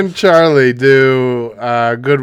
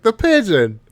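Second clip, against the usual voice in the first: mock release; 11 semitones higher, 3 dB louder than usual. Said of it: "He's like".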